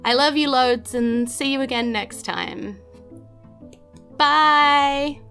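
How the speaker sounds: ordinary talk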